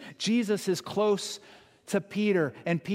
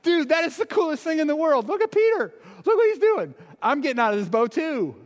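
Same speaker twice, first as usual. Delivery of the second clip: much higher and louder, thrilled; excited disciple; 10 semitones higher, 6 dB louder than usual. Retold or imitated. Disciple in the boat cheering Peter on, high excited shouting. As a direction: shout it like it's the coolest thing ever. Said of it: "and be like".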